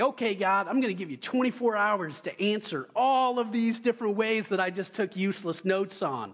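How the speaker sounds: put-on brash teen daring God; cocky prayer. Teenage preacher's kid daring God for an answer, brashly. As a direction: lay down a cocky challenge to God.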